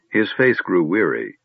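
plain reading voice

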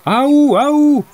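high, loud, drawn-out halloo. A narrator's reading. Second group hailing the first, hallooing loudly. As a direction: call out to someone far off in the open. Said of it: "закричала вторая группа".

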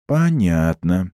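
slow, let-down drawl; disappointed drawl. A narrator's reading. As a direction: stretch it out, disappointed. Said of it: "разочарованно тянет Багира".

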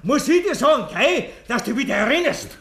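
high, laughing through the words; manic laughter. Acted. Killer laughing madly at the accusation. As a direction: cackle it out, unhinged.

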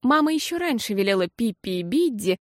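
usual speaking voice

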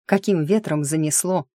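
usual speaking voice

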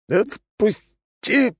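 squeezed wheeze, slow; running out of air. Acted. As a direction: gasp it out, crushed. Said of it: "прохрипел я". (задыхаясь, прохрипеть)

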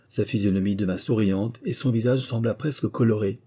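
ordinary delivery

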